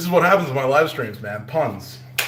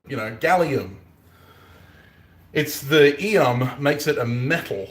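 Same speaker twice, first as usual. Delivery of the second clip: ordinary talk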